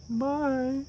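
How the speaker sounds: squeaky high sing-song bye; mock goodbye. Mocking the family waving the tiger off, high mock bye. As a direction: chirp it way up high, cheerfully. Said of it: "They're like".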